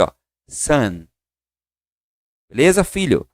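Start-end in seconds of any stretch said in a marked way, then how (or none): 0.5-1.1 son, like Portuguese sã; model pronunciation. Shown as a demonstration. Teacher models son for students. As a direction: say son with an open nasal vowel. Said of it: "praticamente a mesma pronúncia".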